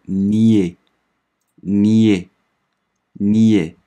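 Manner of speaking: nier stretched very slowly and clearly; slow French demo. Shown as a demonstration. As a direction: drag out nier for the class. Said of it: "所以它读". (极慢示范读nier)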